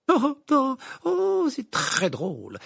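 mock laugh, high and drawn out; sarcastic. A narrator's reading. Hermione answers Ron's teasing about goblins, sarcastic. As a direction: scoff with exaggerated, high amusement. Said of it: "d'un ton sarcastique".

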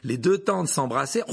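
plain reading voice

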